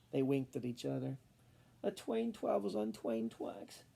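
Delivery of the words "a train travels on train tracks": slow, quiet, miserable reply. Acted. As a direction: drag it out, miserably. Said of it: "replied miserably".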